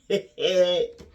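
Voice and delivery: short loud laugh. Real, not acted. Caregiver amused at talk of children as investment, real laugh. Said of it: "I laugh within myself".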